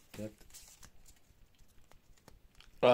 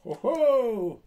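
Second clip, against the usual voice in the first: surprised shout; 11 semitones higher, 6 dB louder than usual. Real, not acted. Reacts to the pull.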